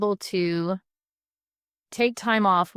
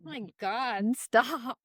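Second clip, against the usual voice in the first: laughing embarrassed; 4 semitones higher, 6 dB quieter, slower than usual. Retold or imitated. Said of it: "I'm like".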